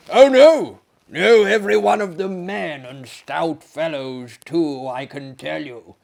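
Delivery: loud, insistent French host; emphatic denial. Acted. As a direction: bark out the no. Maillard protests emphatically.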